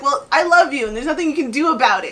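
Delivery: quick, forceful comeback at the end; mock mean. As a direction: fire love back as if an insult. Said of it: "a really mean way".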